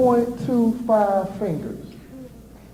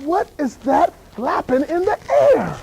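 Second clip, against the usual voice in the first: slow puzzled wonder; 5 semitones higher than usual. Retold or imitated. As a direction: wonder aloud in slow motion. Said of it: "I'm like".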